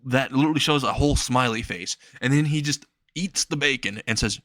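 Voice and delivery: plain reading voice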